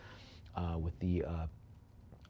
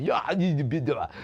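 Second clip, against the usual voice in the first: higher, louder ventriloquist-dummy strongman; quick burst of mock Austrian dummy voice; Arnold; 3 semitones higher, 5 dB louder than usual. Auctioneer trying a dummy act on bidders, mock Austrian voice. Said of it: "the Arnold voice with the ventriloquist dummy".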